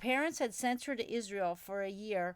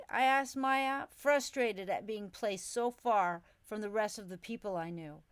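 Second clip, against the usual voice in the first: plain reading voice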